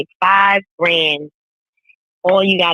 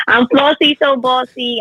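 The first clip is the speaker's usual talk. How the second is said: higher, announcer-style name call; catchphrase intro; 7 semitones higher than usual. Acted. Cohost redoing her intro as a catchphrase. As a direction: sell the name like a brand. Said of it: "I gotta do the intro".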